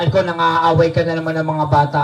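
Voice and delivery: normal talking voice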